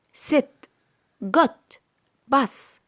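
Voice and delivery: "ست، جوت، باس" each cut short; snatched short words. Shown as a demonstration. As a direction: say "ست، جوت، باس" briefly, vowels unstretched. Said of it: "كلمات مخطوفة وليست ممدودة". ("ست، جوت، باس" مخطوفة مش ممدودة)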